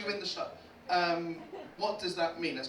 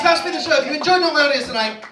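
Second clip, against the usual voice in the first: usual speaking voice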